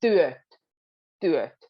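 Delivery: "työt" with a hard T at both ends; crisp both T's of "työt"; strict T's. Shown as a demonstration. Teacher showing the two T's in "työt". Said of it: "strict with both, both T's".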